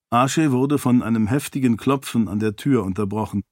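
plain reading voice

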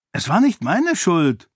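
high-pitched, mildly whiny protest. A narrator's reading. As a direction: defend yourself like an accused child. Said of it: "protestierte Daorn, immer noch ein wenig schwach". (kindlich protestierend)

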